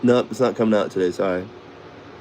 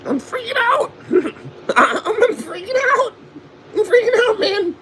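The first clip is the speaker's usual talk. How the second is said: much higher, squeaky cartoon-teen voice, laughing in between; Beavis impression. Imitating Beavis freaking out, in a much higher squeaky cartoon voice. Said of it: "I can do Beavis or Butt".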